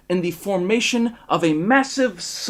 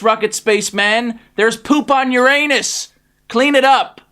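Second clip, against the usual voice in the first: urgent orders; 5 semitones higher, 6 dB louder than usual. Acted. President ordering poop cleanup.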